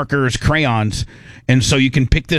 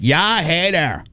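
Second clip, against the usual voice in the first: slow, higher "Yeah, hey there"; Canadian accent; 4 semitones higher, slower than usual. Pretend. Host showing how Canadians say hello. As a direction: draw it out friendly and Canadian. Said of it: "you'd be like".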